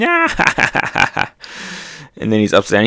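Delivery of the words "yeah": high-pitched cackle; mock cartoon laugh. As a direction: imitate a gleeful character's burst of laughter. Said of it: "he's just laughing".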